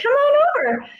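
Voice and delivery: high called invitation; calling back. Retold or imitated. Narrator answering neighbor from afar, high call. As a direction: call it back across the yard. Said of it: "I was like".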